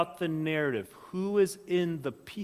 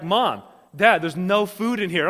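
mock complaining kid; 4 semitones higher, 9 dB louder than usual. Voices hungry kids complaining to parents.

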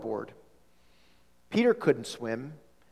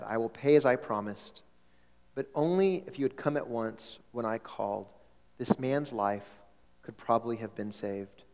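lower, softer, heavy sadness; sad voice; 5 semitones lower, 5 dB quieter than usual. Acted. Missionary quietly and sadly rebuking the fishermen after the drowning. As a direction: hand over the money in quiet grief. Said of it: "His voice was sad".

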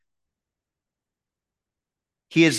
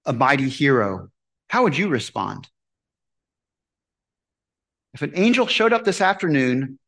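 ordinary talk, nothing marked

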